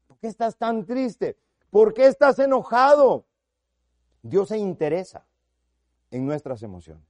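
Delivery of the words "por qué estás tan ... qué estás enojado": God asking Cain. Acted. God questions Cain, voiced.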